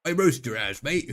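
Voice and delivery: playful Australian-mate taunt; amateur Australian impression; bad Australian accent. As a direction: tease in a mock Australian accent. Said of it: "I can't actually do an Australian accent".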